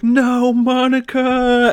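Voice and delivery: high, loud drawn-out mock cry; melodramatic wail. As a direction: imitate horribly overdramatic movie acting. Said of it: "the most dramatic, horrible acting".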